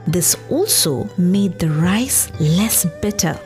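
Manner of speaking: ordinary delivery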